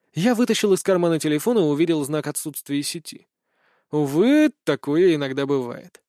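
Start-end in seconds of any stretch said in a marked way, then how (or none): none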